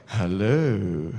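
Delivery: low, sly flat hello; the mystery caller's low reply; mock silent caller. Mimicking the anonymous caller answering back, low and sly. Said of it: "somebody on the side's".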